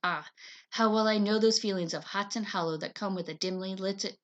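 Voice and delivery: usual speaking voice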